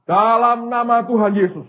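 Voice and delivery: high, loud prayer declaration; loud proclamation. Retold or imitated. Preacher praying in Jesus' name, loud proclamation. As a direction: proclaim it boldly on a sustained high pitch.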